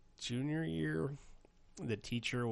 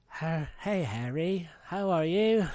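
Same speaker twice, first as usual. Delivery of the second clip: put-on higher, louder falsetto Hermione as a dude faking a girl; dude playing a girl; 8 semitones higher, 8 dB louder, slower than usual. Parent reading Harry Potter aloud, Hermione as a man in falsetto. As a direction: squeak it like a man pretending to be a girl. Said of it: "a dude pretending to be a girl".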